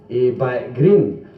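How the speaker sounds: slow, deliberate phrase; slow explaining. Shown as a demonstration. Explaining the back green slowly to the English audience. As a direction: spell it out slowly. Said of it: "this is the way I explained".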